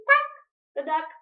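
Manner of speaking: ordinary delivery, nothing marked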